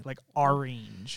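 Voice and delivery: "orange" as ARE-inj, slow; slow Southern "orange" as arinj. Shown as a demonstration. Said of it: "A R E E N J".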